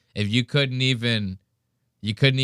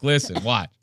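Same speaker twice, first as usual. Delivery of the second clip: ordinary talk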